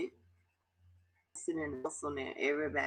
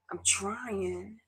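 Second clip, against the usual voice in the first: pitched up, pleading and fragile; strained plea; 5 semitones higher than usual. Real, not acted. Telling viewers she keeps trying, strained plea. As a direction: push it out like a plea. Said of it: "I'm trying not to cry".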